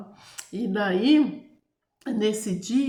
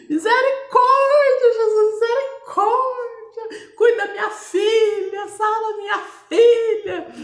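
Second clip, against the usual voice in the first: desperate shouted prayer; 14 semitones higher, 9 dB louder than usual. Retold or imitated. Mother heard praying through the door, desperate shouted prayer. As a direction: wail to God as if your child is dying. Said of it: "ele ouvia minha mãe gritando".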